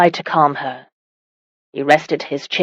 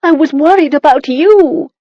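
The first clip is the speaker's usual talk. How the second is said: much higher and louder, vehement; crying out; 10 semitones higher, 6 dB louder than usual. A narrator's reading. Wife vehemently telling husband she feared for him, loud. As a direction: let the worry burst out loud. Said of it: "she cried out".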